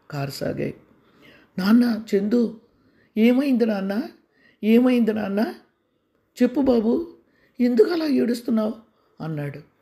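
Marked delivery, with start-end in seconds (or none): 1.6-8.7 higher, louder worried coaxing; urgent fatherly plea to a crying child; anxious pleading father. Acted. Father begs crying son to explain. (ఆందోళనతో బతిమాలే తండ్రి)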